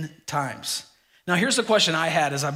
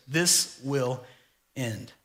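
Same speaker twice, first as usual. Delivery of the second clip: "This will" then a long pause before a much softer "end."; dramatic title pause; 4 semitones lower, 5 dB quieter, much slower than usual. Shown as a demonstration. Announcing the sermon title, slow dramatic pause. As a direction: hold the beat, then drop "end." low and soft. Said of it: "the title of today's Daniel talk is simply this".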